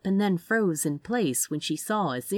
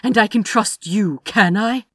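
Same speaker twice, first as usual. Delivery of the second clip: loud, higher, cutting retort; snapped; 4 semitones higher, 9 dB louder than usual. A narrator's reading. Aziraphale challenges Crowley on whether he can trust him, snapped. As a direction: snap it back as a challenge. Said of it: "Aziraphale snapped".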